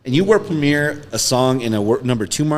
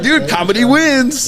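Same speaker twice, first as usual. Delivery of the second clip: much higher, slow triumphant drawl; slow, high, smug victory line; 13 semitones higher, 5 dB louder, slower than usual. Retold or imitated. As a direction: savor it like a proud winner. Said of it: "I was like".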